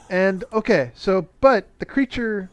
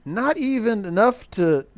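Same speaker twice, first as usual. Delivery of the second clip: ordinary talk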